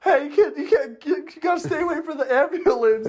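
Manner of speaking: put-on high, loud hey kid; hammed-up worried trucker; mock trucker. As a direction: call after the kid, hammed up. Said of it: "he's like".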